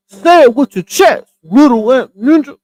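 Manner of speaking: loud, punchy comedian impression; Kevin Hart. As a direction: belt it out in a mock comic voice. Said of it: "in my Kevin Hart voice".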